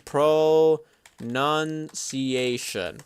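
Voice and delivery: Pronunciation dragged out very slowly. Shown as a demonstration. Streamer repeating Pronunciation very slowly. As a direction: stretch Pronunciation syllable by syllable. Very slow second read.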